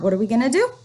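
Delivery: normal talking voice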